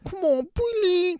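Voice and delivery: put-on a clumsy go at Rosie Perez's Brooklyn accent; mock Brooklyn accent, badly faked. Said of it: "I can't do the accent".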